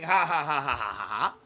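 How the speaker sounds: loud staccato ha-ha falling in pitch; mocking cartoon laugh. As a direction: act out a gloating roadrunner laugh. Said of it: "by the rock laughing".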